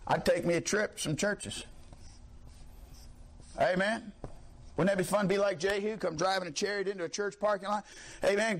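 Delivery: usual speaking voice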